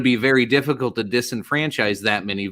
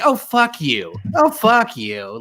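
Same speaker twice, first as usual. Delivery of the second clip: pitched-up, exasperated oh, fuck you; mock outrage, voice jumping up; higher disgusted scoff; 9 semitones higher, slower than usual. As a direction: throw it higher, slower, fed up. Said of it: "I was like".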